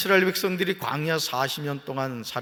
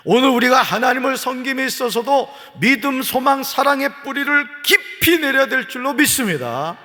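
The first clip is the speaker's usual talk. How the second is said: loud, high pulpit preaching; fervent preaching; 13 semitones higher, 12 dB louder than usual. Real, not acted. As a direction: drive it home to the congregation. Preaching at full force.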